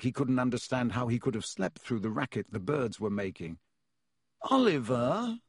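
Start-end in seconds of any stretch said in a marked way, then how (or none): 4.5-5.4 croaked. A narrator's reading. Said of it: "Harry croaked".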